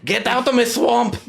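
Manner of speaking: put-on Shrek-style Scottish accent; hammed-up Scottish accent. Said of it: "my Scottish accent going".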